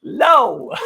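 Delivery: high, loud, laughing no; emphatic refusal. Real, not acted. Reviewer refusing the episode any grace, loud and high. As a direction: slam the door on forgiveness, half laughing.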